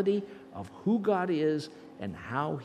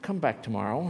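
ordinary talk

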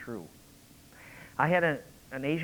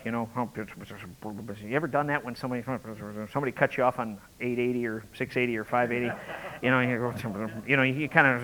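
ordinary delivery

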